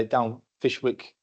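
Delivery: usual speaking voice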